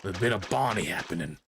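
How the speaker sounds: low, menacing Aussie slang; Australian dolphin. Acted. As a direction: threaten in a low Australian accent. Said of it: "is this dolphin Australian?".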